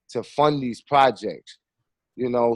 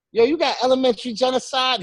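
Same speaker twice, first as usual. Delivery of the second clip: higher, teasing ask about his own film; joking question; 11 semitones higher, faster than usual. Retold or imitated. Filmmaker teasing the street bootlegger about his own film, high joking tone. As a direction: play it as a joke, pitched up. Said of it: "I was just joking".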